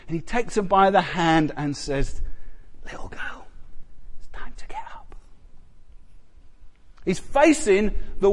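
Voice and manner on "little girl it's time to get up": unvoiced tender whisper. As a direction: wake a child gently in a whisper. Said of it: "it's a little whisper".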